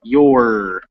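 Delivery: "Your" drawn out into a full R; strong final R. Shown as a demonstration. Teacher repeating for the student, strong final R. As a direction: hold the r at the end of "Your". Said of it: "you really get that R at the end".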